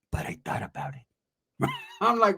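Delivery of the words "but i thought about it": whisper. Retold or imitated.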